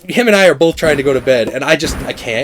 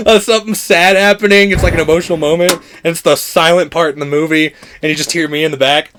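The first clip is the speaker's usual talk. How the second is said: ordinary talk, nothing marked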